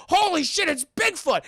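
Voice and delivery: high, loud cartoon alarm; shocked shout. Eustace walking in on Bigfoot, shocked shout. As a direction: exclaim it in mock shock.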